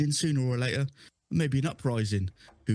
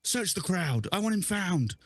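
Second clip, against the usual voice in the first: commanding lady; 7 semitones higher than usual. Acted. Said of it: "she's like".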